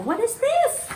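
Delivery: high, rising amazement; real surprise at the box; surprised question. Real, not acted. Opening her prize box for viewers, surprised.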